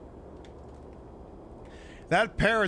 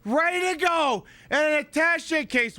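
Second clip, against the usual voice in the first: excited shock; 13 semitones higher, 4 dB louder than usual. Real, not acted. Gamer shocked at loaded bombs, excited rising voice. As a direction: voice shooting up at the bombs.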